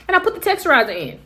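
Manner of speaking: ordinary delivery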